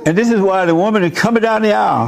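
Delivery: normal talking voice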